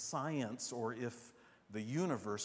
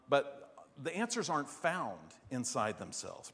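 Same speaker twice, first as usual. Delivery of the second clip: ordinary talk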